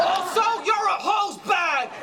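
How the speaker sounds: high, drawn-out parting shout; shouted insult. Acted. Getting the last word on a rival, shouted insult.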